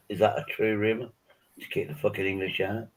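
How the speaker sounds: ordinary talk, nothing marked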